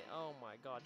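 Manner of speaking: ordinary talk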